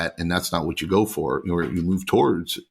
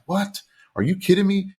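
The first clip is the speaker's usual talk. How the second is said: incredulous disbelief; 12 semitones higher than usual. Retold or imitated. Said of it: "I was just like".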